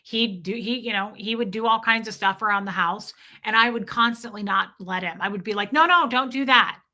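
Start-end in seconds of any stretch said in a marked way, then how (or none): 5.7-6.8 raised, urgent pleading at husband. Retold or imitated. Wife begging her old husband not to shovel, urgent. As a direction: beg him to stop, voice up.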